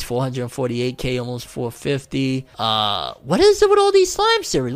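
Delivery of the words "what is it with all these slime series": exasperated squeal. Real, not acted. Exasperated outburst.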